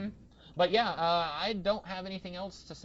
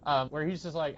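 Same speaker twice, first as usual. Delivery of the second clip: ordinary talk, nothing marked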